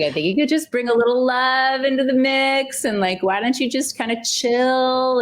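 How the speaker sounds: husband gently telling wife to relax; husband's voice. Retold or imitated. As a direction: lightly mimic the husband telling her to chill. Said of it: "I think he's like".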